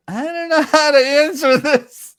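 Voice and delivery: high, loud flustered squeal with laughter; mock panic. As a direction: blurt it out in comic panic, half laughing. Acts his panic before station manager.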